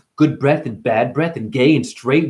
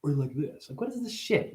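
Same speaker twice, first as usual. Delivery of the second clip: hushed character voice; 3 semitones higher, 11 dB quieter than usual. Acted. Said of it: "These characters of everybody".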